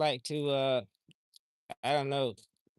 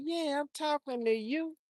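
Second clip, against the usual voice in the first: playful answer; 11 semitones higher than usual. Pretend. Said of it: "I'm like".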